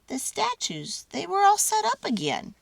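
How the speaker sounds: usual speaking voice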